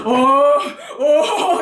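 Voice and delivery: long, loud belted "uh"; Thunder Tiger belting. Shown as a demonstration. Demonstrating the singing technique she ranted in, Thunder Tiger belting. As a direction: haul a drawn-out growly cry up from the belly. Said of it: "천둥호랑이 창법?".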